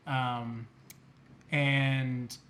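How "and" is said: normal talking voice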